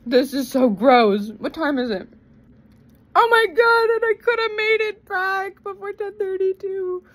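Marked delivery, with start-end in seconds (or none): 3.1-7.0 distraught. Real, not acted. Upset rant, high voice.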